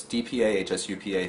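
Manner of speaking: normal talking voice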